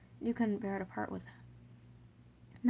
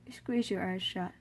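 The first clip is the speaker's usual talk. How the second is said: plain reading voice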